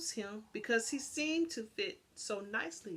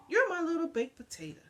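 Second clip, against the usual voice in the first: playful, brisk; 8 semitones higher, 4 dB louder, faster than usual. A narrator's reading. Lady's morning greeting to dog.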